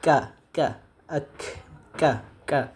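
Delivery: কা with air released from the mouth; aspirated Arabic kaf. Shown as a demonstration. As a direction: push air out on কা. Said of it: "মুখ থেকে বাতাসটা নির্গত হবে". (বাতাস ছেড়ে কা উচ্চারণ)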